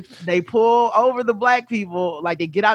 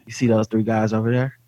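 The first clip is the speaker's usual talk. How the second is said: low, mumbly tough-cop voice; hushed low cop scanning the street; Steven Seagal impression; 7 semitones lower than usual. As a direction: drop the pitch way down like a self-serious lawman. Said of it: "Steven is always the one to be like".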